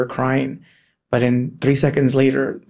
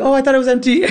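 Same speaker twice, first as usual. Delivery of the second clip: put-on high-pitched playful kid's excuse; retold innocent excuse; 11 semitones higher, faster than usual. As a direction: act out a caught child playing innocent. Retold child making excuse, laughing.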